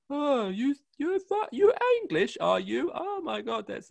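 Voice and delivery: high, loud gushing American voice; imitating Americans fawning over an English accent; mock American fan. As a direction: gush like an excited American tourist. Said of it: "the people who comes up to me".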